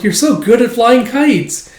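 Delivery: put-on high, loud, drawn-out kid awe; wowed child, voice up high; admiring child. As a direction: marvel like an impressed little kid. Child admires father's kite flying.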